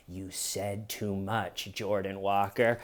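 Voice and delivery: low mock menace; menacing whisper. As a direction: lean in like a villain.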